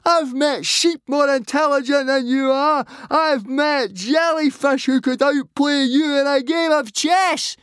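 loud, shrieking insults at top pitch; screaming. A narrator's reading. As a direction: hurl insults in a high, furious scream. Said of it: "screamed Fishlegs".

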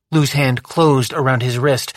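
plain reading voice

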